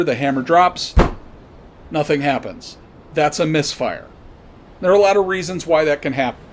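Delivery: normal talking voice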